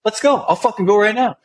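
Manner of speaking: very high, heated acceptance of a dare; retold fired-up challenge. Soldier daring him to go to the recruiter, fired up.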